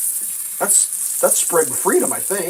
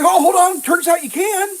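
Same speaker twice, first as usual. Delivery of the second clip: mock surprise; 11 semitones higher, 4 dB louder than usual. Mocking reversal.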